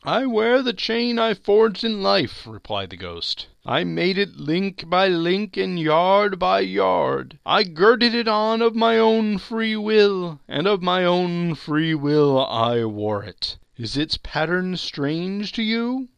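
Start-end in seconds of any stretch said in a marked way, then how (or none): 0.0-2.5 high, loud, hollow spectre; solemn ghost. Acted. Marley's ghost lecturing Scrooge, high and loud. 3.7-16.1 high, loud, hollow spectre; solemn ghost. Acted. Marley's ghost lecturing Scrooge, high and loud.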